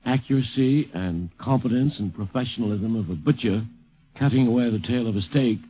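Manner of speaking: ordinary delivery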